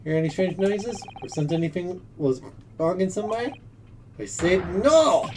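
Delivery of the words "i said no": slow loud angry no. Acted. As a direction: snap it out slowly. Sailor snaps.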